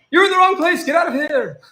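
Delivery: high, loud mock shout at a troll. To trolls in his stream, a high loud mock shout. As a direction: chase the troll out. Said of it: "then I was like".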